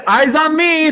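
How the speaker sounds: high sing-song teacher call; kindergarten teacher voice. Acted. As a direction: echo it like a cheerful kindergarten teacher. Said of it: "Christina said".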